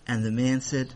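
ordinary delivery

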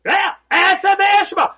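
Aseh v'eshma called out loud, high and slow; hearty, drawn-out Aseh v'eshma; loud affirming version. Retold or imitated. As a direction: belt out Aseh v'eshma with conviction. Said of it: "And he said".

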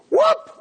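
high, sudden fish-leap whoop; imitated whoop. Rabbi mimics a fish leaping skyward, high whoop. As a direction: let out a quick, soaring "whoop".

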